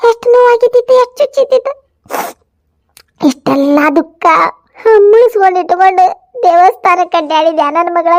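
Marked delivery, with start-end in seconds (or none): 0.1-1.7 tearful crying. Acted. Mother consoles daughter, cries.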